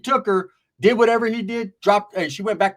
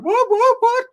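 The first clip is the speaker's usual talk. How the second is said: very high squeaky repeated what; 6 dB louder, slower than usual. Retold or imitated. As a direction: squeak it out in falsetto. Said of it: "They're like".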